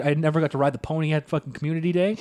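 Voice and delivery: normal talking voice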